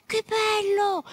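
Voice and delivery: high excited cry; delighted exclamation. Acted. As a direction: burst out with joy. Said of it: "esclamò Franco".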